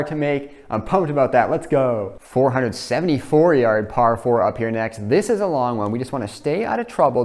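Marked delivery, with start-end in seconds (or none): none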